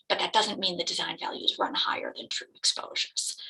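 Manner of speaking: usual speaking voice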